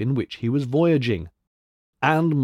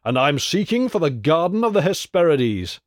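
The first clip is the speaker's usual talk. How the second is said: higher, proclaiming hero's voice; hero thundering back; 5 semitones higher than usual. A narrator's reading. Hercules announcing his quest to the giant, mildly thundering. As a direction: declare it up to the giant, a touch thunderous. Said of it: "thundered back the hero".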